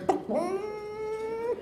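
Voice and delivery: high, soft, long chicken croon; imitated chicken call; chicken noise. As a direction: imitate a clucking hen, drawn out. Imitates the chicken's little dance.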